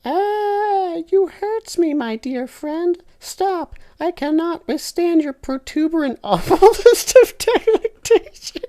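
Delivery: high, loud falsetto wail; falsetto scream. Acted. Tinky Winky screaming at his friend, loud falsetto. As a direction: shriek it in a mock high voice. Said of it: "Tinky Winky screams".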